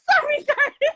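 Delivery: high squealing laugh into an apology. Real, not acted. Laughing after getting hype.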